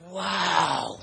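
huge, drawn-out loud wow; hammed-up booming amazement; mock awed crowd. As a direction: imitate a whole room blown away. Whole meeting reacts in awe.

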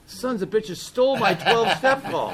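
high, loud retold outburst of indignation; pissed off. Telling the room who took his twelve-step call, pissed off. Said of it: "it pissed me off".

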